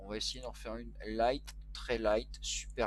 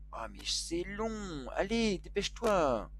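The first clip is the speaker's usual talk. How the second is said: higher, drawn-out exasperated whine; impatient, pitched-up plea to hurry; impatient complaint; 6 semitones higher, slower than usual. Real, not acted. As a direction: groan at the wait, stretching it out. Complaining about slow loading.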